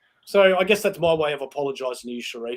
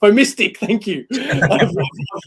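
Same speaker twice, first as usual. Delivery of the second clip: laughing; 6 semitones higher, slower than usual. Real, not acted. Laughs over the made-up word.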